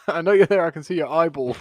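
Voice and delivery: loud, laughing, calling through a door; half-laughing as he yells the line; mock shout through the door. Podcaster retelling a neighbour banging on the door, loud and laughing. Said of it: "he's like".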